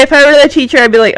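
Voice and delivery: ordinary delivery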